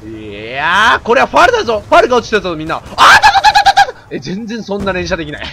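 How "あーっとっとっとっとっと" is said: imitating rapid gunfire with a loud high ah-totototo; machine-gun noise; gun sound. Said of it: "俺の声と合わせて連射できるはず". (口で連射音を大声で)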